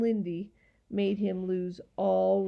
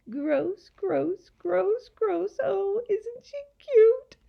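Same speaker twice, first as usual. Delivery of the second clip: high-pitched mocking creatures; simpering sneer; 11 semitones higher, 4 dB louder, slower than usual. A narrator's reading. Gaboons making fun of the girl, simpering and sneering. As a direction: mimic her in a high, simpering voice. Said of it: "The creature simpered and sneered".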